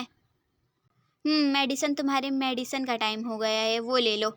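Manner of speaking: usual speaking voice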